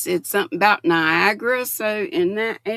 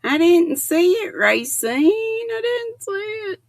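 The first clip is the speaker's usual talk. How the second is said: playful protest; 11 semitones higher, 4 dB louder than usual. Real, not acted. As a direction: sing it up high in mock protest.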